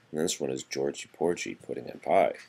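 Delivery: plain reading voice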